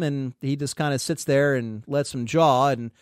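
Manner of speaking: normal talking voice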